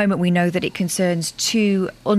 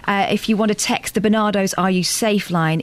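normal talking voice

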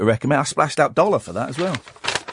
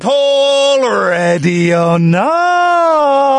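loud, slow game call; sing-song calling out; 10 semitones higher, 5 dB louder, much slower than usual. Pretend. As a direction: call it like a seeker. Hide and seek call.

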